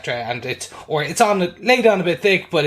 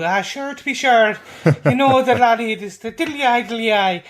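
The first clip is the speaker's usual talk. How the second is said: hammed-up stage Irish brogue, higher and louder; mock the cliché TV Irish lilt; 7 semitones higher, 3 dB louder than usual. Mocking how American TV fakes an Irish accent, hammed-up stage Irish brogue. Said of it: "a slightly stereotypical".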